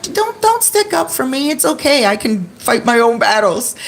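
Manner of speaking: retold high, urgent objection; high-pitched protest. Telling her friend not to fight for her, high-pitched retold protest.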